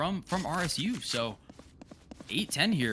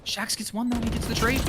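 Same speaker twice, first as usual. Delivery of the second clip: high, fast excited play-by-play; esports caster; 12 semitones higher, 5 dB louder than usual. Acted. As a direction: shout the play as it happens. Live play-by-play call.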